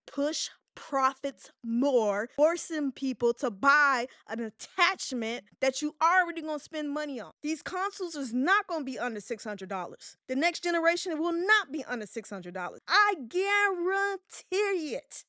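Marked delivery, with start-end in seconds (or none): 0.1-2.3 very slow, word by word; spelled-out emphasis. Shown as a demonstration. Host repeating it for the cheap seats, slow and emphatic. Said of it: "say it again for the people in the cheap seat". 12.9-15.2 drawn-out, higher-pitched catchphrase guarantee; Charles Barkley. Acted. Host borrowing a basketball pundit's catchphrase, slow drawn-out impression. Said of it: "you watch Charles Barkley, you know where I got that from".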